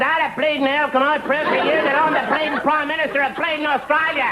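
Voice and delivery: high, indignant Australian rant; exasperated Australian. Acted. Prime Minister argues with Saint Peter, exasperated Australian rant.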